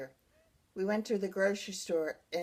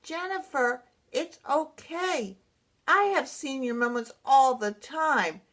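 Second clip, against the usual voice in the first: higher-pitched, drawn-out placating voice; retold reassuring sister; 6 semitones higher, 4 dB louder, slower than usual. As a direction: lightly imitate a sister soothing someone upset. Sister soothes her in the retelling.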